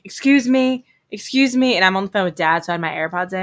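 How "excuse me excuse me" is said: lightly voiced officer calling her, a bit higher; a hint of a cop calling after her. Police officer calling her in the parking lot, mild mock call. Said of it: "a police officer is like".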